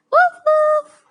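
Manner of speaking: high, loud yappy woof; dog bark. Pretend. Teacher imitating dog bark.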